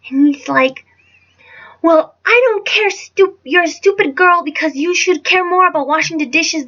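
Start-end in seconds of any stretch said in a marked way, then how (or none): 1.8-6.7 raised, scolding mimicry; imitating angry stepfather. Stepfather berating a girl over dishes, imitated angry scolding. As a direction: scold in a higher, mock-angry voice.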